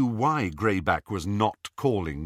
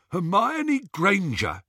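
higher, sneering henchman; snatcher voice; 9 semitones higher than usual. A narrator's reading. Scabier to Greyback, a sneering higher voice. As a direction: announce her name like a prize. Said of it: "Scabier was saying".

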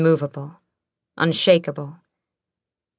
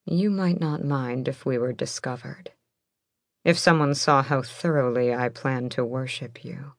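low, soft seductive murmur; 3 semitones lower, 4 dB quieter than usual. A narrator's reading. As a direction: murmur it like a promise. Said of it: "his breath skittering along her skin".

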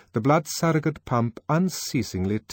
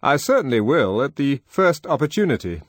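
other man; 7 semitones higher, 7 dB louder than usual. Acted. Colleague replying to Henry.

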